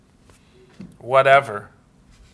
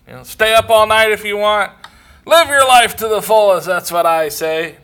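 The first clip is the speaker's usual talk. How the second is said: loud, high mock old-timer bluster; hammed-up old man preaching excess; old man boasting; 7 semitones higher, 9 dB louder than usual. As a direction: bray it like a carefree old codger. Old man brags about excess.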